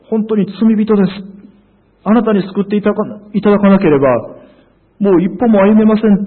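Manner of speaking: high, loud confession of helplessness; acted surrender. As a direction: plead upward as if admitting total defeat. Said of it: "もう本当に降参して認めて". (降参して訴えるように)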